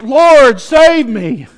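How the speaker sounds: high, loud cry to heaven; desperate plea shouted in a sermon. Acted. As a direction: call out for rescue, loud and high. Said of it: "he cries out".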